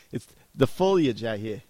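loud, put-on Massachusetts accent. Shown as a demonstration. Co-host showing how "foliage" sounds, in a Massachusetts accent. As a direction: ham up a Massachusetts accent, dropping the R's. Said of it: "say it with that Massachusetts act".

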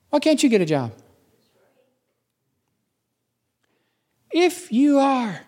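high, goading tempter; devil's taunting questions. Acted. The devil needling someone about a hard life, high taunting challenge. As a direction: jab each question high, with falling endings. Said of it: "what's the attack of the devil".

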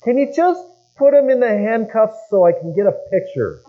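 high, loud teasing call from a camper door; mocking yell. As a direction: shout it out across the camp, poking fun. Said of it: "he yells out of".

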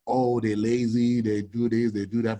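slow, dismissive mock complaint; mocking the critics. Older critics griping about millennials, mildly mocking. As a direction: lightly parody the people who call millennials lazy. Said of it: "everybody is always hating on the millennials".

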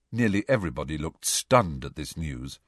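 usual speaking voice

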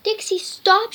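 loud high shout. Acted. Girl yelling at her dog to stop. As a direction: yell it across the room. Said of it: "shouted Emma".

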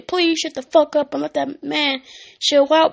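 ordinary delivery

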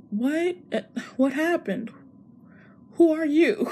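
bewildered. Retold or imitated. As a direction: ask it lost and bewildered. Confused woman meets Miguel.

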